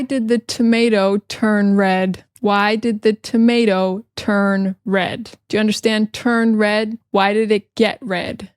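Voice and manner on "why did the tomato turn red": drawn-out, careful question; slow repeat. Shown as a demonstration. Teacher slowly repeating the joke question. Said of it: "repeats slowly".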